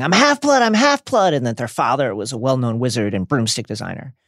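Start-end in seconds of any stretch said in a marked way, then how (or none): 0.0-1.3 high, loud desperate wail; acted high-pitched panicked plea; pleading. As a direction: cry out pleading for mercy. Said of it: "The person is pleading".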